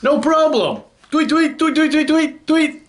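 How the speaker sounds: tiny, very high chirpy voice; obliging bird. Acted. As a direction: squeak the answer, then twitter rapidly. Birds answer the elephant.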